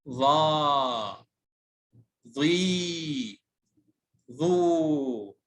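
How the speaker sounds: ظا، ظي، ظو each stretched out long; long vowels. Shown as a demonstration. Teacher reviewing long sounds with pupils, ظا، ظي، ظو drawn out. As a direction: hold the vowels of ظا، ظي، ظو. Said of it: "بالنسبة للصوت الطويل".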